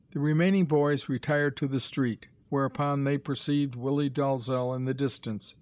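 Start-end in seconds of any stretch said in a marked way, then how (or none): none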